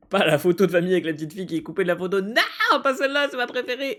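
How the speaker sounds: high, fast, laughing protest; frantic pleading. Real, not acted. Player begging to spare the family photo, frantic. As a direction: plead in a panic while laughing.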